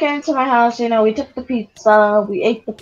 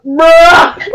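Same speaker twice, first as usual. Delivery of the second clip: higher, louder mock protest; loud bruh; 5 semitones higher, 9 dB louder than usual. Kid answering his dad at the door, loud bruh. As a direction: blurt the one word loudly. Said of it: "then I'm like".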